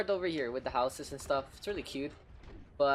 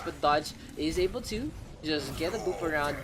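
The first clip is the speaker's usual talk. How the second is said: ordinary talk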